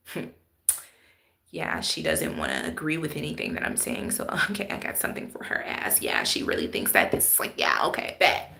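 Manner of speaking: low, hushed, half-whispered plotting; scheming inner voice. Retold or imitated. The fake person plotting quietly against her, hushed. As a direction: mutter it like a schemer. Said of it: "now they looking at you like".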